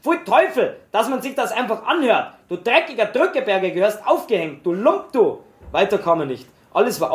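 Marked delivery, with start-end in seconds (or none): 0.0-5.4 high, barked threats at the coward; furious high-pitched outburst; menacing bark. Acted. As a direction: snarl it at the man like a bully. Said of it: "bellte mordialisch drohend".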